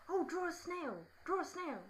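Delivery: high put-on boy's pestering; eager schoolboy. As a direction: mimic an excited boy making a request. Acts boy in other line begging.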